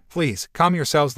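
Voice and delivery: plain reading voice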